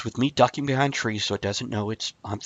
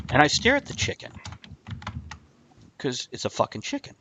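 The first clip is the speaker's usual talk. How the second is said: ordinary talk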